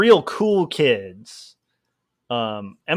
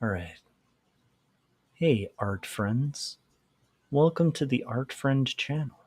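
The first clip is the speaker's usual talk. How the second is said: soft art host voice; 2 semitones lower, 5 dB quieter than usual. Pretend. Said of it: "If I was just".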